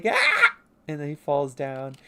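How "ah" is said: very high, loud yelp; cartoon scream. Superhero stepping over lava and falling, cartoon scream. Tay falls after heroic line.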